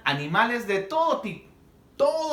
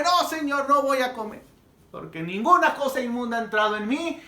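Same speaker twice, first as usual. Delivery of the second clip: much higher, louder protest; shocked insistent refusal; Peter refusing; 7 semitones higher, 4 dB louder than usual. Acted. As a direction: plead no with rising alarm. Peter refuses third time.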